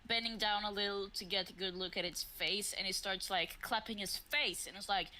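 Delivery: ordinary talk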